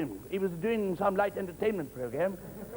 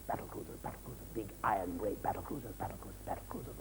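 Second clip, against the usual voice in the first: whispered, much softer repetition of battle cruisers; fading whisper; 8 semitones lower, 11 dB quieter, faster than usual. Acted. Old Navy veteran drifting off, whispering. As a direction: let the words trail off into a whisper. Old naval man reminiscing.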